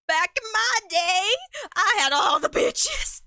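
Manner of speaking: put-on high, drawn-out old man holler. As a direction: shout it slow like a cartoon old-timer. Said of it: "wearing, like, an old man mask".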